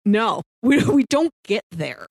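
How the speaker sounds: ordinary delivery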